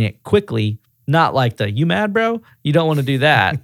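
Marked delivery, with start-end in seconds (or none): 1.7-2.4 high-pitched needling question; mock taunting. Shown as a demonstration. Showing how not to address an angry customer, tauntingly. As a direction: tease him, voice jumping up.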